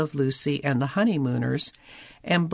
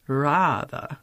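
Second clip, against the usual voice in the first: upper-crust Brahmin voice. Retold or imitated. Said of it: "in the high, breathy voice of an upper-crust Brahmin".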